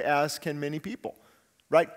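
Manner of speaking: normal talking voice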